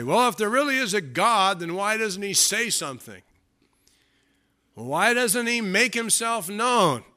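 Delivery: high-pitched doubter's protest; mock complaint. People ask why God stays silent, mockingly plaintive.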